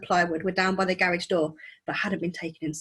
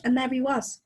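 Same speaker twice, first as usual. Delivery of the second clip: usual speaking voice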